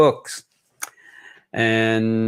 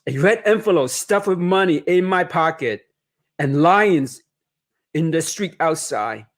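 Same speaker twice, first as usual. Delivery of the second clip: story narration; 2 semitones higher than usual. A narrator's reading. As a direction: read it gently like a bedtime story. Author reads picture book aloud.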